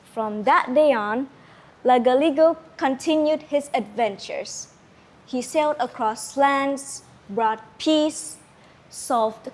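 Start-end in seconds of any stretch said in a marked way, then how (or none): none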